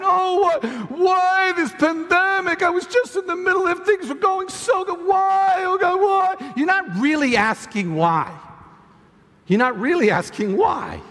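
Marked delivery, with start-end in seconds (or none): 0.0-6.5 whiny crying. Acted. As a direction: wail like someone throwing a pity party. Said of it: "You're crying. You're having what we call a pity party".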